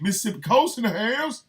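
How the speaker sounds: high-pitched call to the crowd; hype shout-out. Real, not acted. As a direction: holler it up high. Shout-out to listeners.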